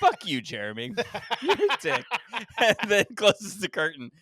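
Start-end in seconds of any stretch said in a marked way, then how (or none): 0.0-2.0 mock celebrity insult, louder and laughing; lightly acted put-down through laughter; Ryan Murphy. Famous guest insults the podcaster from behind a curtain, mock abuse. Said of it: "the curtain opens and it's Ryan Murphy".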